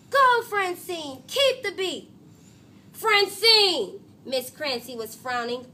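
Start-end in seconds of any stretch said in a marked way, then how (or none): 0.1-2.1 loud, high, drawn-out cheer. Acted. Crowd in her daydream cheering Francine, loud drawn-out cheer. 2.9-4.0 loud, high call of her name; sharp call. Acted. Music teacher calling to wake Francine, loud sharp call.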